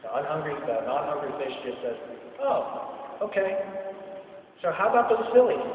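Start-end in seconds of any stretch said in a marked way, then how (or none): 2.4-5.6 dodging the subject. Pretend. Non-hungry fish changes subject.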